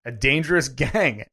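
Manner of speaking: usual speaking voice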